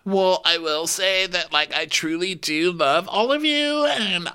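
very high put-on voice turning peeved; mock sincerity. Professing love, interrupted, high mock-peeved voice. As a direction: gush high, then quicken into annoyance. Acting Victoria addressing the group.

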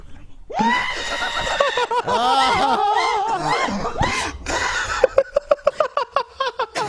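long, high laughing shriek; shrieking scream-laugh. Retold or imitated. Retelling the roommate's scream from the bathroom as a long high shriek. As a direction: let out the howl you heard upstairs.

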